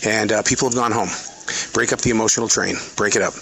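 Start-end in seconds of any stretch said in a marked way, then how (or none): none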